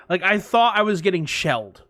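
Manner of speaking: ordinary talk, nothing marked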